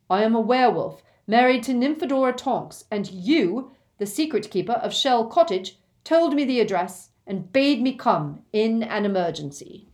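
loud, steady, projecting; urgent calling. Acted. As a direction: announce yourself through a shut door. Lupin calling through the door.

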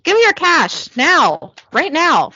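punchy but restrained loud order; loud demand. Acted. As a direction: demand it loudly, without a full shout. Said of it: "Ty says loudly".

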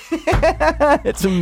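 high, slow words through laughter; laughing. Real, not acted. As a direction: laugh it out slowly. Laughing at the mom's line.

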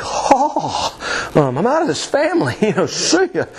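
high-pitched mock gasp and protest; hammed-up outrage, pitched high with a laugh; mock dramatic dismay. Acts a child's reaction to losing sports.

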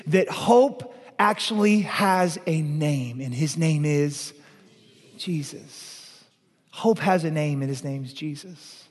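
slow, then dropping to near silence on Jesus; hushed reverent reveal. Acted. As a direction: let the name land softly, almost breathed. Preacher builds to a hushed name.

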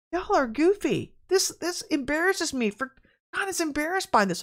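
high-pitched retold rebuke; exasperated, high retort in a retelling; exasperated scolding. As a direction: pitch it high and tell them off. Retold reply to offended church people.

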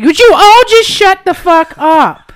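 loud, slow exasperated yell; retold shout. Yelling at aunt and friend over the TV, retold shout. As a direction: blast it at the people crowding the TV. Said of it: "And I".

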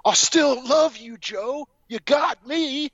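high, slow, sappy parody of the fiance; mock lovesick fiance. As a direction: lay it on thick like a clueless groom. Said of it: "she's gotta marry is like".

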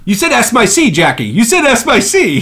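hammed-up tattletale whine; mock accusation. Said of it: "And he goes".

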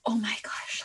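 breathy, whispered mock gasp of panic; retold panic. Student alone in the staircase mid panic attack, retold in a whispery gasp.

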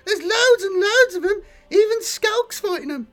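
very high, loud squeaky grot gabbling; frantic report. Acted. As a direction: rattle off the news in panic.

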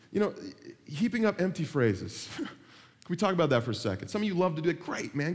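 normal talking voice

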